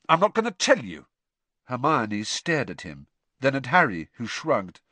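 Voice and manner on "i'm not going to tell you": higher-pitched, scornful; cutting, indignant scorn; scathing. A narrator's reading. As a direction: deliver it with scathing contempt. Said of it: "said Ron scathingly".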